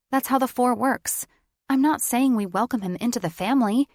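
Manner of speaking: loud, high heated point. A narrator's reading. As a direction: argue it out hotly. Heated argument.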